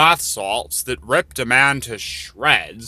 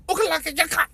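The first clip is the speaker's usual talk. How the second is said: much higher caricature gibberish voice; mock gibberish; much faster than usual. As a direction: squeak out mocking nonsense words. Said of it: "they say weird things like".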